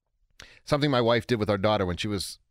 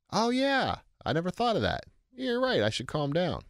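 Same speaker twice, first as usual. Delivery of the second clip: mock agreement; 6 semitones higher than usual. Mock compliance of angry man.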